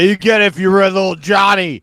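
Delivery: loud, high comic husband rant; exasperated bellow in a played quarrel; mock angry yell. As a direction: shout her down, hammed up. Acted husband yelling at wife.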